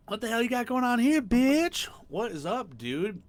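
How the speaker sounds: high, loud, rowdy hello to a buddy; loud greeting. Real, not acted. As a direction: shout it like you're thrilled to see him.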